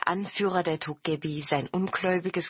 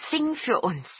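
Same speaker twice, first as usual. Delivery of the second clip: higher-pitched eager request; lively goblin urging; calling out; 7 semitones higher than usual. A narrator's reading. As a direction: call out a keen plea. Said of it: "riefen die Togepi".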